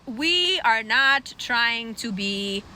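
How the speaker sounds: proud Irish. Acted. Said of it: "The Irish are like".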